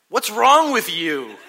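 loud, high, slow exasperated yell; hammed-up scolding shout; retold yelling. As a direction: act out yelling in disbelief. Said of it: "I'm, like, yelling at her".

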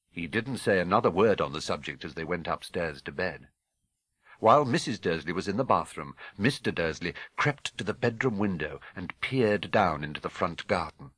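ordinary delivery

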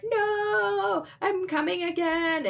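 high, drawn-out mock wail; high-pitched hammed-up moan. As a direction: wail it high, acting out a character overcome. Acted cry of climaxing character.

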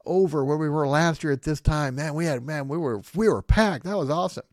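hammed-up pastor boasting about a packed church; excited pastor. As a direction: brag like a thrilled pastor. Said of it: "here's a pastor talking about".

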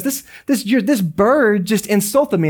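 high, sputtering mock outrage; indignant complaint. Customer reporting the rude bird to the store owner, indignant. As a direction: stumble over the words, comically offended.

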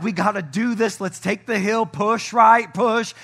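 mock coach's shout, driving them on; rallying yell. Pastor urging his church up the hill, rallying yell. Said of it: "I'm screaming".

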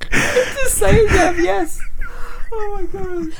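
high, loud words through tears of laughter; can barely talk for laughing; laughing till he cries at the joke; crying with laughter. Real, not acted. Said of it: "I laughed so hard".

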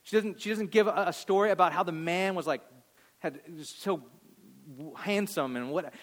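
usual speaking voice

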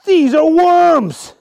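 loud, high, long drawn-out cry; acted appalled outburst; horrified disbelief. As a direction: exclaim in stretched-out, high horror. Archie finds worms served as food.

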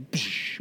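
soft hissed explosion sound; imitated blast noise. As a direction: make a quick mind-blown "psh". Imitates verse's mind-blowing impact.

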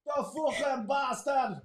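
hammed-up mock Connor grumbling. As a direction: squeak it out as a grumpy Connor. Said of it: "what's Connor think?".

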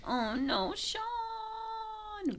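high, long wail of dismay; dismayed cry. Real, not acted. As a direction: cry out the name in mock grief. Dismay at seeing Sean.

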